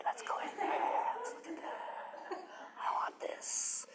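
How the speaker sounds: conspiratorial whisper, slowing at the end; excited whisper. Retold or imitated. Teacher whispering her eagerness to enter the herb spiral. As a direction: whisper like sneaking toward treasure.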